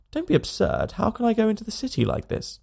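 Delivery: hoger gespeelde George, verontwaardigd; George indignant. A narrator's reading. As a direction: protesteert gekwetst tegen zijn vrienden. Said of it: "He said".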